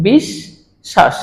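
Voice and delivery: বিশ্বাস very slow, split bish-shash; doubled শ pronunciation. Shown as a demonstration. Teacher demonstrating how we pronounce it, doubled শ pronunciation. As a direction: stretch বিশ্বাস into two halves, both শ sounded. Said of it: "আমরা কিভাবে উচ্চারণ করছি".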